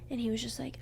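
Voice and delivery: ordinary talk, nothing marked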